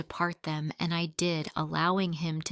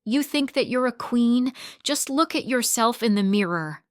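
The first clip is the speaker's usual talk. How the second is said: loud, higher mocking yell; screaming back; 5 semitones higher, 4 dB louder than usual. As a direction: shout the taunt right back at her. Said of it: "The actress screamed back".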